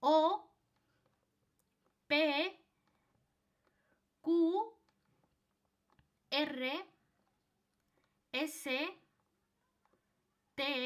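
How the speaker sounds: each Spanish letter name said slowly on its own, like আ, বে, ফে, দে; pausing after every letter so the class can follow; slow letter-by-letter recital. Shown as a demonstration. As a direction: recite the alphabet for students to repeat. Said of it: "যেভাবে আমরা pronunciation করছি".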